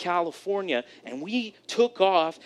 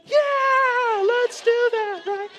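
much higher, louder, squeaked mock panic; squeaky scared yes; 4 dB louder, much slower than usual. Passenger agreeing to land the failing plane, mock terrified squeak.

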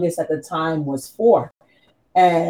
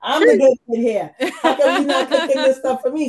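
mock indignant, laughing; 6 semitones higher, 3 dB louder than usual. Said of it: "I'm like".

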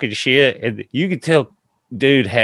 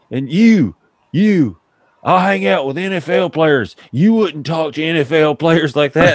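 mock indignant club owner; 7 semitones higher, 4 dB louder than usual. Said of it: "he looks at me and he's like".

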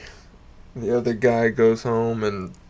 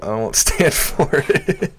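laughing through the words, higher and slow; breaking into laughter; 6 semitones higher than usual. Real, not acted. Comic retelling his failed joke, cracking up. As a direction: let the line dissolve into laughter.

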